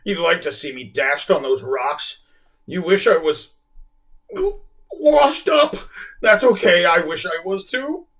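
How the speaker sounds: choking sad whale, rising loud; choked sadness. A narrator's reading. Whale answering the girl, choked and sad. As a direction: sob it out bitterly. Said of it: "the whale choked sadly".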